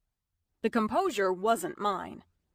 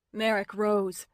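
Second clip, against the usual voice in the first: ordinary delivery, nothing marked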